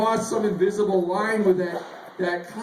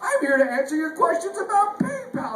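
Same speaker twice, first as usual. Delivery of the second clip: prim chatbot voice; 7 semitones higher than usual. Retold or imitated. PayPal bot rebuffing an insult, higher and prim. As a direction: recite it like a prim customer service bot. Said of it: "And she goes".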